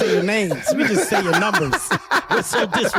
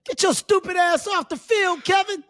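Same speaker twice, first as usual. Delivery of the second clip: high jeering call; pitched-up mock abuse; mock heckler holler; 9 semitones higher than usual. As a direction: order him off in a high fan voice. Fans heckling him off field.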